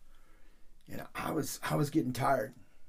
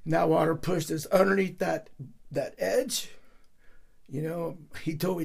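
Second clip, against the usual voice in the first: normal talking voice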